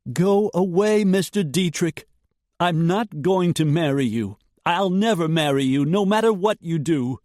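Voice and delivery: high, loud, defiant refusal; defiant young woman. A narrator's reading. Jessica shouting back at Dietrich, defiant.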